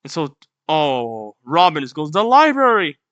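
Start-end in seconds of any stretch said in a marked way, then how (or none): 2.1-3.0 higher mock-alarmed cry; alarmed exclamation. Robin seeing the library on fire, acted alarm. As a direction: call it out in mild alarm, pitched up.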